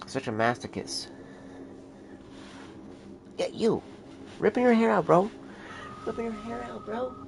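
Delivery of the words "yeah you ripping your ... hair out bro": much higher, teasing the cat; high pet-talk voice. Real, not acted. Owner teasing the cat about his hair in a high voice. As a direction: coo a mock scolding up high.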